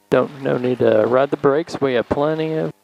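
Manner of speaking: normal talking voice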